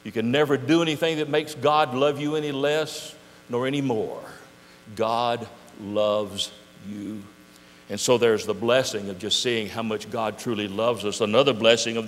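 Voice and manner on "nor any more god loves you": slow, soft and tender; very slow, softer, reassuring; tender assurance. Acted. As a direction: lay it gently on each listener.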